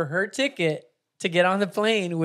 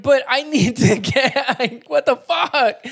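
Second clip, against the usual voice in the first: desperate disbelief; 9 semitones higher, 8 dB louder, slower than usual. Retold or imitated. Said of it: "she's like".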